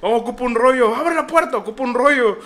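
high, loud plea through a door; hammed-up urgent shouting; retold calling out. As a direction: yell for help from the bathroom. Retells calling through the bathroom door.

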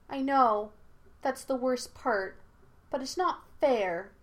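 voiced as a child pleading unfairness; unhappy protest. A narrator's reading. Aang protests unfairness after swallowing.

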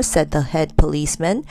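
ordinary delivery

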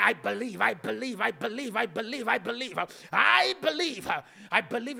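rising, emphatic chant of I believe; preaching declaration. Acted. Pastor leading the congregation in declaring, emphatic. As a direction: drive the repeated line home, building.

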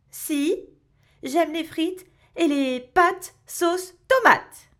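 put-on loud, slow, high child protest; whiny child. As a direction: stretch it out like a stubborn kid. Child protests to his mother. (enfant qui proteste fort)

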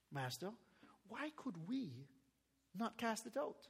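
hushed private question from the disciples. Acted. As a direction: ask it quietly, like a secret.

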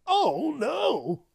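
high, loud fake fright; mock panic. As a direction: cry out in pretend terror.

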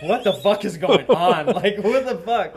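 high, laughing disbelief; laughing through quote. Retold or imitated. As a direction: squeeze the words out between laughs. Bystander's shocked reaction, retold.

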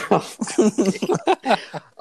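high cackle at his own joke; big silly laugh at his own pun; corny dad laugh. Real, not acted. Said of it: "laughing at my own jokes now".

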